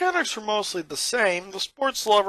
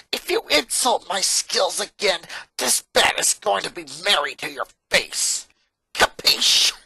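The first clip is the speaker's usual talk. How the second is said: menacing threat; 4 dB louder than usual. Acted. Lynn threatens Lincoln inches from his face, menacing. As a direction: threaten him up close.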